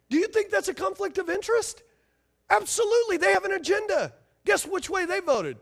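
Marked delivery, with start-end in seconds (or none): 0.1-4.1 much higher, pressing the crowd hard; fired-up preaching. Real, not acted. Preacher pressing his congregation for an answer, fired up. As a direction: push the question up high and loud.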